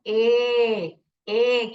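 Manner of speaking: ए held long, saying its letter name; long open vowel. Shown as a demonstration. Teacher showing how an open syllable sounds, long vowel. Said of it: "वो चीख के अपना नाम बोलेगा".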